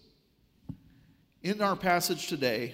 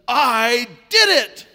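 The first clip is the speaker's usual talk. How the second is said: loud, high, drawn-out mock victory cry; triumphant boast; 5 semitones higher, 13 dB louder, much slower than usual. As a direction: crow it like a child on a summit. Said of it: "stands triumphantly".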